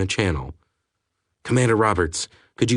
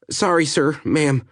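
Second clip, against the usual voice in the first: hurried apology, pitched higher; quick apology, voice raised in pitch; said quickly; 7 semitones higher than usual. Acted. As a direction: rush the sorry out, higher up. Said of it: "he said quickly".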